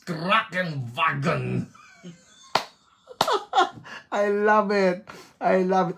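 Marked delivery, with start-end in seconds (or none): 0.0-1.7 German pronunciation. Shown as a demonstration. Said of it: "the way the Germans pronounce things".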